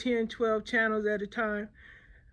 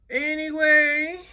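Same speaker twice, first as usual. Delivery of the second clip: drawn-out anyway; 5 semitones higher, 6 dB louder, slower than usual. Real, not acted. Drawn-out topic change.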